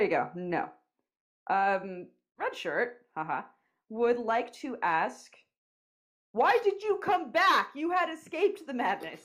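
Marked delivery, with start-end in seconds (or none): 6.3-9.2 slow, high, theatrical plea; dramatic voice. Acted. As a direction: ask it like a haunted soldier. Dramatic question from Red Shirt.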